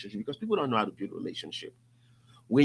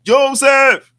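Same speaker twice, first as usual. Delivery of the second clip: loud shout across the course; calling out; 12 semitones higher, 17 dB louder than usual. Retold or imitated. Golf buddy hailing Joseph from afar, loud call. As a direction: call his name out big and high.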